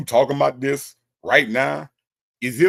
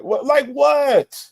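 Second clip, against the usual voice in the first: high, drawn-out question; mock bewilderment; 12 semitones higher, 5 dB louder, much slower than usual. Host reacting to Justin's mom's comment, mock baffled. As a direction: leap up and stretch it.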